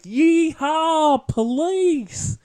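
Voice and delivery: high, loud mock Southern yokel whoop; hammed-up Southern conservative cheer; Southern accent. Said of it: "Sorry for doing the Southern thing".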